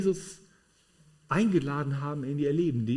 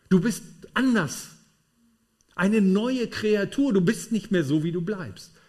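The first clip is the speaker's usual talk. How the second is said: 1 emphatic proclamation; 5 semitones higher, 4 dB louder than usual. Acted. Preacher proclaiming new creation.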